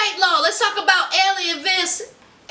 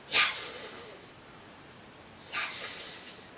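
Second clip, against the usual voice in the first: breathy, hushed delight; whispered yes; 15 dB quieter than usual. Real, not acted. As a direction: whisper it to yourself. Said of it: "I said".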